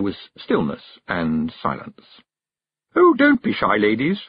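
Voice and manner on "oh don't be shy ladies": high, loud coaxing, dropping at the end; strained. A narrator's reading. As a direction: coax them with mounting strain. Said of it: "getting more strained by the second".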